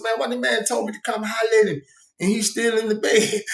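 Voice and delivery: high, baffled complaint; can't believe he's still in bed; incredulous. Retold or imitated. Retold complaint to Twin.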